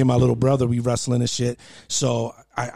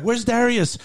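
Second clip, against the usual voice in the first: higher mock mom calling out for her son; 9 semitones higher, slower than usual. Mom calls through the house looking for her youngest, higher voice. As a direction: pitch it up like a worried mom calling.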